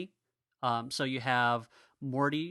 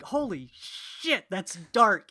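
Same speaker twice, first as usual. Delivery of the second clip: shocked; 7 semitones higher, 5 dB louder, slower than usual. Retold or imitated. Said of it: "it's one of those things where it's like".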